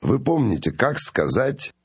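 ordinary delivery

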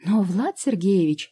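loud, slow indignant protest. A narrator's reading. Медсестра возмущённо спорит с профессором об увольнении. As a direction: drag it out in outrage. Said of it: "возмутилась Жанночка".

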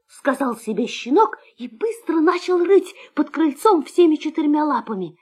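ordinary delivery, nothing marked

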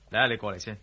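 quick, quiet words through a chuckle; half-laughing as he beckons; laughing while talking. Acted. Amused, calling friend closer. (笑住講)

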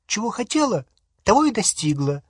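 high-pitched, querulous comeback; peevish retort. A narrator's reading. Hermione answering Ron's doubt about her spell, peevish. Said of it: "сварливо ответила Гермиона".

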